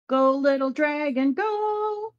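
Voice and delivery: mock cheer. Retelling the crew cheering the dragon on, rising mock cheer. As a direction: urge the little dragon on, climbing up high.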